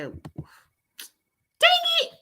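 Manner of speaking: sudden high squeaky yelp; high squeal of annoyance. Real, not acted. Creator fumbling the screen recording, squealing dang it. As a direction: yelp at your own slip-up.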